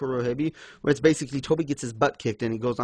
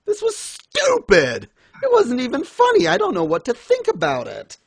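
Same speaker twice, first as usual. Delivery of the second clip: high-pitched, loud outburst of disbelief; exasperated rant; 12 semitones higher, 5 dB louder than usual. Real, not acted. Reviewer reacting to the sock scene, exasperated outburst. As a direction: yell it out, then ease down in bafflement.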